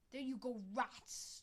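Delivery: growled. A narrator's reading. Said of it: "Stoneclaw growled".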